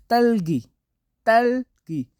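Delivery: careful model of तलगी; Korean word for strawberry. Shown as a demonstration. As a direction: demonstrate तलगी as a Korean speaker would. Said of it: "इस तरह तलगी".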